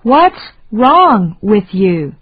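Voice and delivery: usual speaking voice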